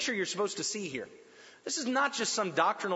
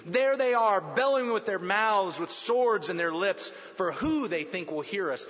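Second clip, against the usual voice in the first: louder, declaiming psalm reading; dramatic scripture reading; 5 dB louder than usual. Acted. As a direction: proclaim the verses like a preacher. Reads the psalm aloud.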